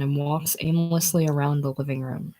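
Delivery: ordinary delivery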